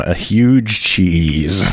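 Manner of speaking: low, stretched-out savouring of the words; drawn-out mock announcement. Writing the silly cheese joke down, drawn-out mock announcement. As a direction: draw it out slowly, keep it low.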